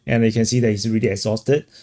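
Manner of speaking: ordinary talk, nothing marked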